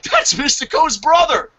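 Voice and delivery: high-pitched fan exclamation; excited outburst. Retold or imitated. Fan recognizing the wrestler's brother, excited outburst. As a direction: blurt it out in starstruck disbelief. Retold shocked fan reaction.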